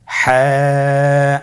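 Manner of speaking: হ্যাঁ held long from the throat; drawn-out Ha. Shown as a demonstration. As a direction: stretch হ্যাঁ slowly, squeezed throat. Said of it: "আপনারা আমার সাথে বলুন". (গলা চিপে টেনে হ্যাঁ)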